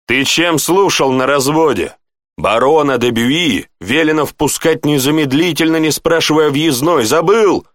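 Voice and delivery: exasperated officer's rebuke; scolding. Acted. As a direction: dress down a careless subordinate. Lieutenant scolding a guard.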